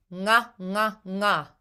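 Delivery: nga with a back-tongue ng, no G; ng drill. Shown as a demonstration. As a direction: repeat nga, tongue at the roof. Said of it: "an N G with your tongue".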